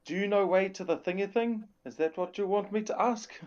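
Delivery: high-pitched in-character yell, played for comedy; yelling out in Giant. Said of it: "Yells out in Giant".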